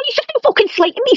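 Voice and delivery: wife on a livestream, shrieking high at Paul for slating her; outraged. Real, not acted. Furious wife defending herself on stream.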